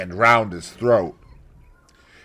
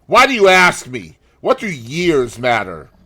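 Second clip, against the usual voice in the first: suspicious; 6 semitones higher, 8 dB louder than usual. Acted. Suspicious gunman.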